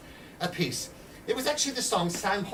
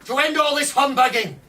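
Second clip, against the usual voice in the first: much higher and louder, a pub-room firebrand call; rabble-rousing agitator; 7 semitones higher, 8 dB louder than usual. Retold or imitated. Edwards recruiting men in pubs, loud, high and rousing. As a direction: whip up the drinkers with a loud, high call to revolt. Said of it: "he would go around saying".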